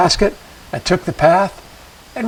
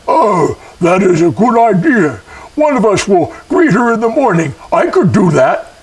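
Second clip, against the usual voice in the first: a hint of an eager spirit; lightly voiced spirit volunteering; strange spirit voice; 4 semitones higher, 4 dB louder than usual. A narrator's reading. First spirit offering to greet her, lightly eerie. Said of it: "none of them sounded quite like people".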